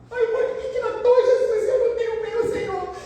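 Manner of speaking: put-on high, whiny child's plea; criança chorosa imitada. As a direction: mimic a crying child asking why. Crianças chorando imitadas pela professora. (voz aguda de criança chorosa imitada)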